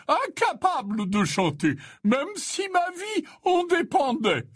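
put-on high-pitched, chuckling old man; high, merry self-mockery; jovial old Slughorn. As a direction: joke in a high character voice, ending in a chuckle.

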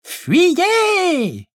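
high grating ghostly wail; horrible hoarse voice. Acted. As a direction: screech it like a ghost. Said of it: "répéta Chantal de son horrible voix rauque".

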